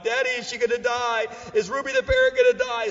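mock child's wail, rising high; imitating crying kids. Kids asking Daddy about the sick parrot, mock tearful pleading. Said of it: "The kids were crying".

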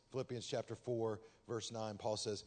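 ordinary delivery

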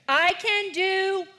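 high, slow loud prompt; leading recitation. Shown as a demonstration. Pastor starting the verse for the kids, high and slow. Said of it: "So read them with me".

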